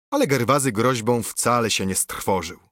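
ordinary delivery

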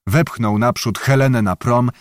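ordinary delivery, nothing marked

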